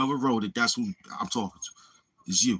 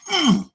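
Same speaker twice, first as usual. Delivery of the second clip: high, loud effort grunt; punch grunt; 7 dB louder than usual. Acted. Showing the troll a punch in the throat, effort grunt.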